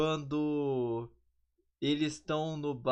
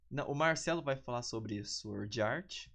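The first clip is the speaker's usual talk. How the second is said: normal talking voice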